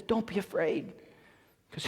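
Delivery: very soft and calm, almost murmured. Retold or imitated. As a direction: reassure him gently and quietly. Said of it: "Elijah so calmly just says".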